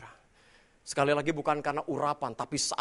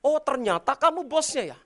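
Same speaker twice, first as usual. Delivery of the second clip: loud, high mocking jeer; sneering shout; 10 semitones higher, 6 dB louder than usual. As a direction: taunt the boss. (ejekan keras dan sinis)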